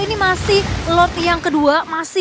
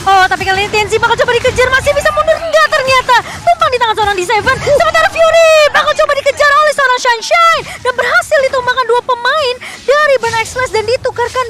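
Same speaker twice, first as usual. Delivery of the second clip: esports caster; 7 semitones higher, 6 dB louder than usual. Real, not acted. Fast play-by-play.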